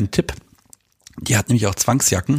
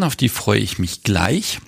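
ordinary talk, nothing marked

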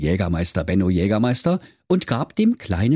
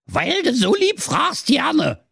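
very high witch voice; slower than usual. A narrator's reading. Witch agrees to share her gingerbread, witch voice. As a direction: answer in a high-pitched old witch voice. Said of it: "sprach die Hexe".

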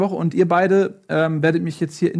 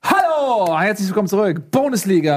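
bright, raised show-host welcome; announcer voice; 4 semitones higher than usual. Acted. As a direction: greet the audience like a TV presenter. Host welcomes back after break.

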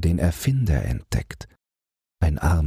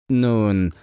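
higher, irritated reply; annoyed; 6 semitones higher, 3 dB louder than usual. A narrator's reading. Passenger answering a question about the train, annoyed. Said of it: "antwortet jemand ärgerlich".

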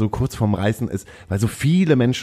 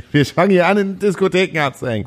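laughing through it; 8 semitones higher than usual. Real, not acted. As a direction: get the line out while still cracking up. Restarts sentence after fumble, laughing.

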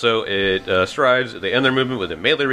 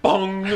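bong sound effect; 9 semitones higher than usual. Pretend. Imitated shield sound.

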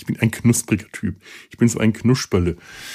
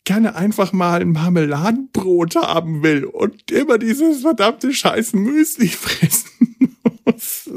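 put-on whiny child voice; 9 semitones higher than usual. Imagined child forced to eat muesli.

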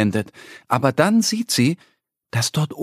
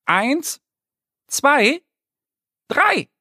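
rising, ever higher countdown; counting loud; 13 semitones higher, much slower than usual. Acted. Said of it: "Annie zählt laut".

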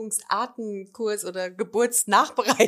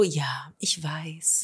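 fake soothing whisper; 2 semitones lower, 8 dB quieter, much slower than usual. Acting fake calm reassurance to child.

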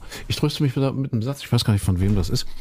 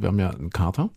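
ordinary talk, nothing marked

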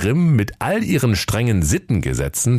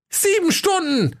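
very high, squeaky reproach; reproachful dwarf. Acted. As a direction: protest it as an offended little dwarf. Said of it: "sagte der Hauke vorwurfsvoll".

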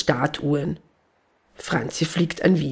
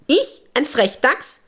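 much higher and slow, indignant squeak; offended little gnome, affronted; outraged gnome; 8 semitones higher than usual. Acted. Gnome called a rascal by Hanni, squeaking with outrage. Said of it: "ruft die Stimme empört".

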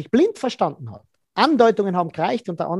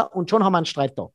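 ordinary delivery

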